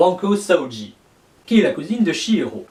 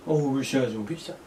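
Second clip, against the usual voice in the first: soft zombie voice; 3 semitones lower, 8 dB quieter, faster than usual. Pretend. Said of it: "Tu es un zombie, toi?".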